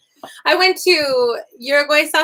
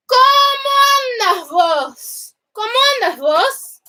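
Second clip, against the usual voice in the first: high, sing-song Uruguayan Spanish; Uruguayan accent; 11 semitones higher, 3 dB louder, slower than usual. Shown as a demonstration. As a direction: imitate a Uruguayan greeting, pitched way up. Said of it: "they talk very similar to".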